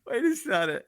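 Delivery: high, loud, laughing through the words; can't stop laughing; real giggling apology. Real, not acted. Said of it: "this is such a funny".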